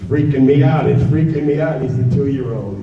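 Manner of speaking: normal talking voice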